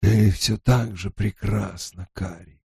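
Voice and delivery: slow, hushed, hoarse and tender; hoarse soothing whisper. A narrator's reading. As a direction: murmur it hoarsely, soothing, half asleep. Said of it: "хрипло произнёс успокаивающим шёпотом".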